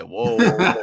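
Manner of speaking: high, laughing "whoa, whoa, whoa"; startled laughing whoa. Retold or imitated. To a friend, recoiling at wild New York talk, laughing whoa. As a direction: pull back from the crazy talk with a laugh. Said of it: "and you're like".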